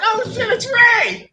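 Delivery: high, drawn-out mock cheer; hyped-up cheer. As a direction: whoop it like thrilled players spotting their hero. Players' hyped reaction acted out.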